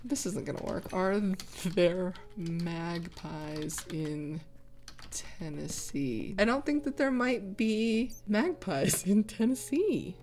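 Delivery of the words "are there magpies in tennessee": slow and drawn out, as if typing; slow dictation. Real, not acted. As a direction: spell out the search slowly. Slowly dictating while googling.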